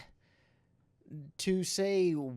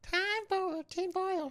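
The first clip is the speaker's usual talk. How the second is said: squeaky mock voice. Mocking conspiracy talk.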